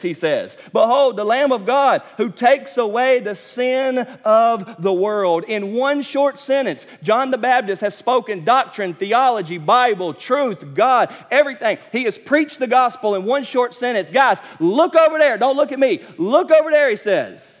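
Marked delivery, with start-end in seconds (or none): 0.7-5.4 loud, slow proclamation; proclaiming. Acted. John pointing the crowd to Jesus, loud slow proclamation. 14.1-16.9 high, loud excited urging. Acted. John telling the crowd to look at Jesus, high excited urging.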